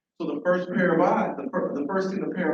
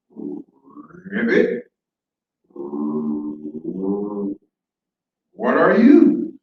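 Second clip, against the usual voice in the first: rolled croak, then a loud drawn-out question; slow, booming inquisitive frog; 10 semitones lower, 5 dB louder, much slower than usual. Acted. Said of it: "is what the frog asks".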